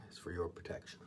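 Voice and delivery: whispered aside to the camera; catchphrase murmur. Real, not acted. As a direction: drop to a whisper for the pet phrase. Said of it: "as I always like to say".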